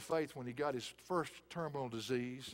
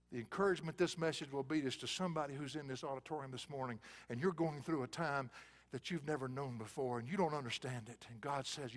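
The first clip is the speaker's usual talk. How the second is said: ordinary talk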